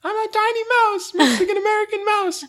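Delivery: very high, squeaky mouse voice; tiny mouse voice. Acted. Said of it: "Why does she sound like a tiny mouse".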